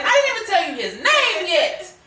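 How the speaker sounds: high, loud indignant protest; livid daughter. Retold or imitated. Daughter protesting to her mom about guys brought home, livid. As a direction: retell an outraged objection, pitched right up. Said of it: "I would be livid".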